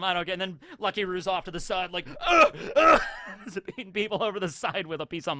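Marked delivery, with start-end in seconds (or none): 2.3-3.0 high, loud effort grunts; grunting swings. Retold or imitated. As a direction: grunt like you're clubbing someone. Pirate clubbing people with mutton.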